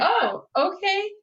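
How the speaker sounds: high, drawn-out uneasy okay; retold hesitant agreement; taken-aback agreement. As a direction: go up high and stretch it, unsure. Startled reply to being told get in.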